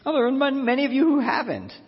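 high-pitched, playful astonishment; mock surprise. Preacher teasing the congregation after a show of hands, mock-surprised. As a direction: act surprised, pitched well up.

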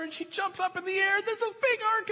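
normal talking voice

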